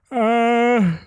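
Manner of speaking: a big open yawn with a high loud sound. Real, not acted. A podcaster yawning mid-story, big yawn. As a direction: yawn right through the sound. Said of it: "sorry about the yawn".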